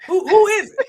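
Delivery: high, loud, ready to fight; fired-up challenge. Retold or imitated. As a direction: rev up like heading out the door. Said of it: "Mine is usually".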